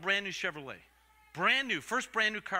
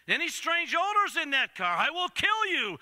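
loud, high, slowed ultimatum; hammed-up furious dad; threatening father; 10 semitones higher, 6 dB louder than usual. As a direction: hammer out each word as a warning.

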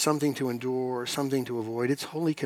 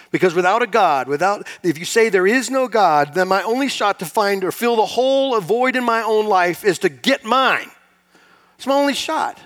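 louder and higher, building pulpit push; preaching at full force; 6 semitones higher, 7 dB louder than usual. Acted. Pastor pressing the congregation about filling the void, loud preaching. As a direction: press hard on the emptiness of life without God.

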